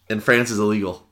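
ordinary talk

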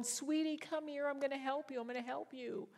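put-on high, soft baby-talk to a kitten; sweet high-pitched reassurance; tender coaxing. Coaxes frightened kitten.

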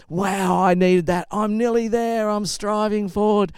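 high, eager walker spurred on; mock grateful relief. Imitating encouraged walkers' grateful relief.

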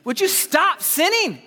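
a fed-up God half joking about wiping out the city; God losing patience with a sinning city, mock exasperation; exasperated God. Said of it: "God would get frustrated".

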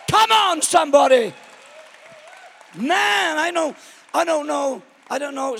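high, loud fiery preacher; full force preaching. Real, not acted. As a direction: preach it to the rafters.

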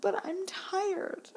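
put-on high, drawn-out little-kid whine; whining daughter. Four-year-old dodging her eye drops, whining. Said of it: "And she was like".

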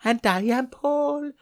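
slow, creepy sing-song madman voice; drawn-out creepy lullaby tone; unhinged villain. Pretend. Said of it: "Talking like a madman and doing sing-song bloody creepy lullabies".

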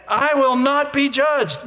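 high, slow and sure, every word planted; confident tone. Shown as a demonstration. Pastor shows the congregation a confident declaration. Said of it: "Say it with a confident tone".

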